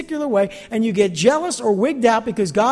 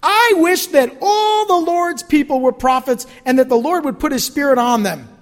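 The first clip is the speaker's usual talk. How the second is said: rebuking Moses; 6 semitones higher, 6 dB louder than usual. Acted. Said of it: "Moses replied".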